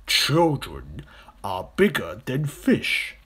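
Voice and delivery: loud, very slow crocodile voice; persuading crocodile. Acted. Big crocodile arguing children beat fish, slow and emphatic. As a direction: spell it out with relish.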